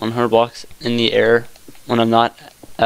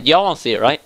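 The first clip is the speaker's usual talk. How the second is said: ordinary delivery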